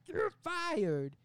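high, loud shout in bad New York accent; Tom Hardy. Boss sacking his employee, shouted bad New York accent. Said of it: "The bad New York accent".

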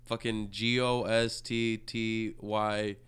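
normal talking voice

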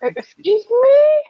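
high, slow offended excuse me; mock outrage. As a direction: play it hammy and scandalized. Offended by the age joke.

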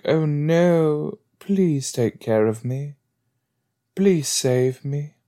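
hammed-up plea to be rescued; mock damsel in distress. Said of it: "I wanna be a damsel in distress".

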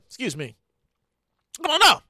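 usual speaking voice

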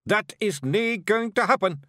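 loud, high Scottish outburst; sudden shout from a wee clan chief; defiant shout. A narrator's reading. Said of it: "shouted Rob Anybody".

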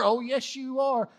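loud, high mock rebuttal; emphatic contradiction. God answers Sarah's denial in the retelling, loud emphatic contradiction. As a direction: fire back with playful certainty.

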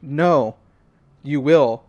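loud, firm answer back; defiant retort. Retold or imitated. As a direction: throw it back at the voice. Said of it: "and I say".

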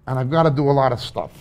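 ordinary talk, nothing marked

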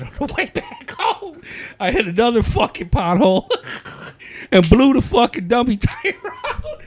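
high and laughing through the friend's words; can barely get the story out for laughing; laughing retelling. Retold or imitated. Quoting his homeboy's second flat to cohost, laughing high.

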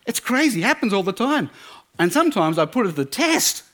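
very high, mounting amazed rant; wildly excited. Acted. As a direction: rave like an overexcited true believer. Boyfriend raves about magic table.

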